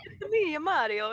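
high, hammed-up Italian accent; mock Italian accent. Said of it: "but going like this".

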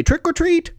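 put-on high child voice calling trick or treat; kids calling out. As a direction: call out like an excited kid. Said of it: "The kids say".

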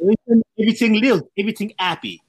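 put-on quick, high, lilting Caribbean accent. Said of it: "the lilt in Caribbean accents".

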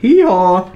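high, loud hee-haw; donkey bray. Pretend. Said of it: "Siger du lyden?".

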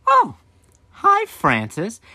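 drawn-out high knowing "Oh, hi, Francis"; knowing mom greeting. Retold or imitated. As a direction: stretch it out like a mom who already knows.

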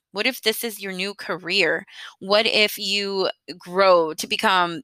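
normal talking voice

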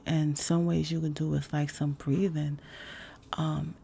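ordinary delivery

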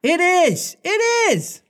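very high excited cries; boys crying out. Acted. Lost boys cheer Wendy, very high excited cry. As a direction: squeal it like delighted boys. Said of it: "they all cried".

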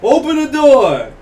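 loud, slow, commanding mock yell; retold police shout through the door. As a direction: bark it like a cop at the door. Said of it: "I hear my name going".

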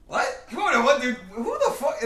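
high, incredulous what; can't believe what you're hearing; shocked disbelief. Retold or imitated. Shocked reaction retelling boyfriend scene.

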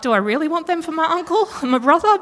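high, fast, disbelieving put-on voice; a mock-horrified rhetorical question; incredulous. Reader questioning their biblical relatives, high mock disbelief.

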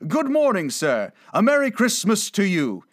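high, loud, hearty hail; jolly passer-by singing out; good-humored greeting. Acted. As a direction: call out a cheery holiday greeting. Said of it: "good-humored fellows said".

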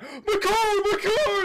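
loud, very high imitated wail; hammed-up frantic cry; mock panic. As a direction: shriek it like a car owner about to be hit. Acting out car owner's panic.

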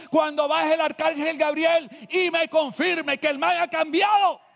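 high, loud fervent preaching; preaching at full force. Acted. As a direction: preach it out to the whole church. (predicando a todo pulmón)

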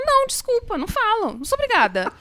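high-pitched prissy refusal; high mock defiance. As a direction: toss it off in a shrill put-on voice. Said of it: "ela fala". (recusa afetada, voz bem aguda)

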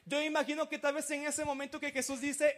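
usual speaking voice

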